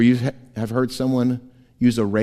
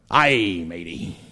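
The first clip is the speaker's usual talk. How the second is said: pirate talk; 7 semitones lower, 4 dB quieter, slower than usual. Pretend. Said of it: "my best, uh, swashbuckling impersonation".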